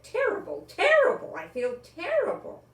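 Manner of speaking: woman moaning about her runaway cat, exaggerated wail; hammed-up lament. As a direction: overplay the grief like a stage diva.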